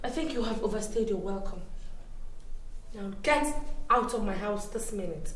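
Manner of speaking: low-volume, icy dismissal; contained anger. Acted. Host telling friend she has overstayed, quietly angry. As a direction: hold the anger down, very soft and controlled.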